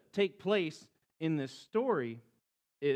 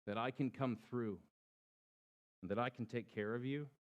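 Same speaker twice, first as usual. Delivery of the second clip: gentle God voice; 4 semitones lower than usual. A narrator's reading. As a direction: ask them tenderly to trust. God's voice to believers.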